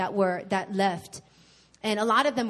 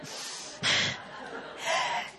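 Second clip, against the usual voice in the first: choked sob; 5 dB quieter than usual. Retold or imitated. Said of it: "I started to cry".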